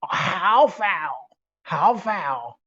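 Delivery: imitated Sichuan dialect speaker; drawn-out Sichuan dialect exclamation; Sichuan dialect imitation. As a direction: mimic overheard Sichuan dialect. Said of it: "I hear someone speaking Sichuan Hua".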